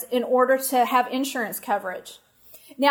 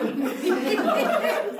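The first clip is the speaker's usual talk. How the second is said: laugh; 8 semitones higher than usual. Real, not acted. Laughing at herself.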